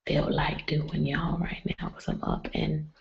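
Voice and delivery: whispering so the house stays asleep; hushed late-night whisper; quiet whisper. Real, not acted. Vlogger whispering live late at night so nobody wakes. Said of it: "I'm gonna tell you guys".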